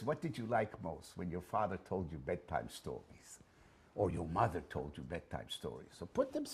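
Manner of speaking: ordinary talk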